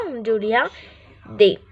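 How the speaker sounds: normal talking voice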